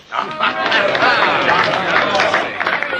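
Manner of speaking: a short pleased chuckle; gleeful laugh. Acted. Crabbe chuckling to the gang over the scam, gleeful laugh. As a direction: laugh with satisfaction at the plan.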